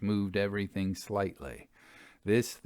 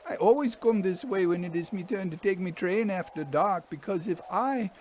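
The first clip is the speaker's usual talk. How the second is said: higher voice of an uneasy railwayman; haunted engine driver; 10 semitones higher than usual. Acted. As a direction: play the driver, set apart from the narrator, pitched up. Fern's warning.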